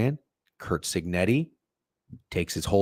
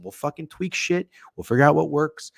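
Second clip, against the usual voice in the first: ordinary talk, nothing marked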